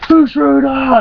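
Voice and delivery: high-pitched holler at a passerby; sudden prank yell. Retold or imitated. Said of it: "and he just goes".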